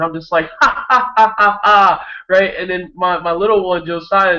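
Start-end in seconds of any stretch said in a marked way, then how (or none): 0.6-2.1 high, loud ha-ha laughter; hearty laugh. Retold or imitated. Dad laughing while holding his son's hand, loud laugh. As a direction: keep laughing for no reason. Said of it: "I keep laughing".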